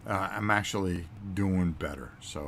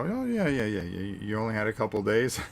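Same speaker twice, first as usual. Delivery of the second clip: customer service voice; 3 semitones higher, 4 dB louder than usual. Retold or imitated. Imitating company rep on phone.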